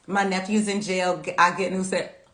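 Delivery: normal talking voice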